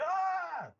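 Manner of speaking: high, loud mock shriek; mock scream. Brother sees himself on camera, mock scream. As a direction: imitate a startled scream.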